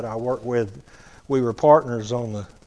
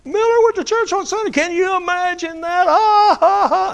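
high, loud jeering cackle over the words; mocking laugh; 6 dB louder than usual. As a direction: crow it out, mocking and delighted. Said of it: "broadcasting it to all the guys".

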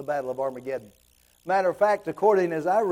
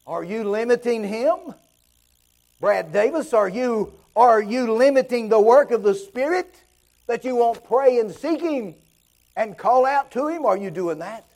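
a hint of a prophet confronting the listener, pushing louder toward the end; challenging prophet; 5 semitones higher, 4 dB louder than usual. Ezekiel challenges Brad Davis, lightly voiced pleading challenge. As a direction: press the question home like a prophet. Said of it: "And Ezekiel says".

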